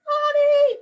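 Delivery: high, loud playful shout of Mommy!; mock scream for mommy. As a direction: wail for mommy as the coaster drops. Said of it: "Maybe I scream for my mommy".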